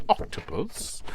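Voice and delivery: high, rising "Octopus" in disbelief; surprised. Shown as a demonstration. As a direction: gasp out "Octopus" with a rising question. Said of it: "you say".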